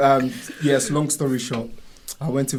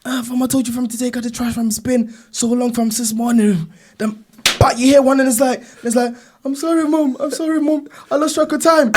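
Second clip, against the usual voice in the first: high animated retelling; lightly voiced friend mimicking himself; 10 semitones higher, 3 dB louder than usual. Friend recounts mum telling him off, higher voice. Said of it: "And then he was like".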